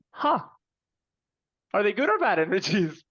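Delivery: high, suspicious mock question; wary disbelief. Asking his co-writer about the energies, high and mock-wary. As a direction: raise it up, half teasing, half uneasy.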